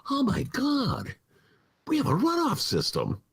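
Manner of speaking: high, slow mock gasp of discovery; mock surprise. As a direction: act stunned to find out. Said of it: "Chicagoans wake up and go".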